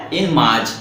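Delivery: usual speaking voice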